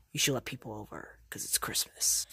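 soft, low whisper; hushed Spider-Man urging Mario; whispered advice. Acted. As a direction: lean in and whisper the tip. Spider-Man urging secret party.

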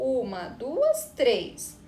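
counting "Uma, duas, três" slowly, one beat each; teacher counting slowly for the kids; slow syllable count. Shown as a demonstration. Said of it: "Vamos contar".

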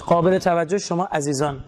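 ordinary delivery

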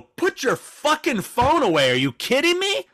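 high, loud angry yell at the couple. Retold or imitated. To a rude couple in the cinema, a high loud angry yell. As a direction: snap at them to put the phone away. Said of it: "Like that".

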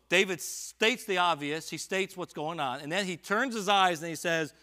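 fervent scripture proclamation; full-force preaching. Acted. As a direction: proclaim it to the congregation. Preacher building to climax.

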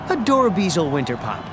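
plain reading voice

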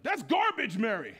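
high, loud scorn; scoffing dismissal. Retold or imitated. The men scoffing at Mary, high and loud. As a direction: brush her off loudly.

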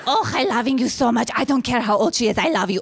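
doting mother as a croaky cave creature; Gollum. Retold or imitated. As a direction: fawn possessively in a creature voice, accented. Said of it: "like, Lord of the Ring Gollum on me".

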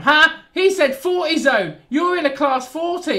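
loud, high mocking outburst; mock jeering. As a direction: crow it loudly like a heckler catching a slip. Mock jeering at his own remark.

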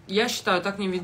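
normal talking voice

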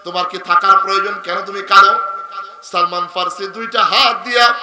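melodic preaching. Real, not acted. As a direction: chant the story, swelling into loud cries. Preacher tells story in melodic chant. (সুরেলা ওয়াজের টানা গলা)

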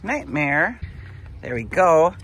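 real proud pet-owner voice; proud praise. Real, not acted. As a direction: cheer her on like a proud owner. Praising her hen's first egg.

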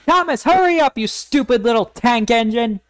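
high-pitched bossy engine, easing down at the end; acted irritated order; impatient scolding. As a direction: hurry along a lesser engine crossly. James scolds Thomas.

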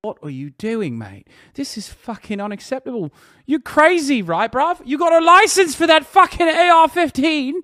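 hammed-up British bloke, rising to high indignation; exaggerated British scolding, ending high and loud; mock British accent. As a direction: put on a British accent and get worked up. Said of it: "they're like".

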